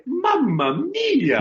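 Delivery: very high, slow cartoon Italian exclamation; Mario. Shown as a demonstration. Voice actor giving the host a line to copy, Mario. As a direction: stretch it out high, falling at the end. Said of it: "Say that then".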